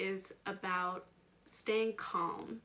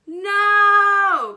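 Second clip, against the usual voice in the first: much higher, very loud desperate cry of no; child's cry; 13 semitones higher, 23 dB louder than usual. Acted. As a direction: shout it like a child who has had enough. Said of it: "cries Charlotte".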